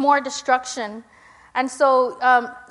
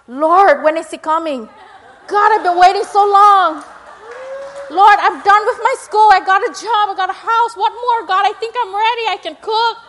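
loud, high, comically desperate plea to God; impatient pleading prayer; 7 semitones higher, 9 dB louder than usual. Acted. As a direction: plead your case to heaven, hammed-up and exasperated. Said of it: "that's my personal prayers out loud".

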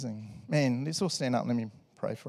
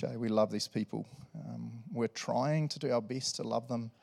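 softer, low spoken prayer with pauses; heartfelt prayer; 3 semitones lower, 3 dB quieter than usual. Real, not acted. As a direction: talk to Jesus simply and sincerely. Leads the church in prayer.

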